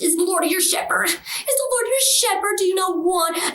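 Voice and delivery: high, mocking screech of her prayer; mocking devil. Devil mocking her prayer, high-pitched jeer. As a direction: throw her prayer back in a high sneer.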